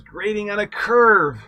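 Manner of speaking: high, loud punchline; a loud, high-pitched bad-joke punchline. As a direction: land the pun loud and high. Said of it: "Okay, they're terrible".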